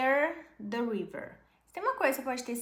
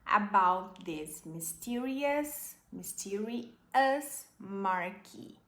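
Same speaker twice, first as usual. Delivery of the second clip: slow model reading; much slower than usual. Shown as a demonstration. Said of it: "slow model reading".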